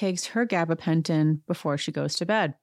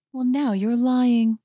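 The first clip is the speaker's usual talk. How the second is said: slow, higher-pitched mock accusation; imitated accuser, stretched and pitched up; retold accusation; 6 semitones higher, 3 dB louder, slower than usual. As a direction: draw out the accusation, mimicking her. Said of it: "eight point five says".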